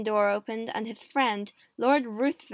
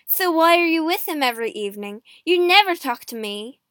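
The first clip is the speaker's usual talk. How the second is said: loud, high-pitched reproach; angry accusation; 4 semitones higher, 8 dB louder, faster than usual. A narrator's reading. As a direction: throw the blame at her loudly. Husband accuses wife in quarrel.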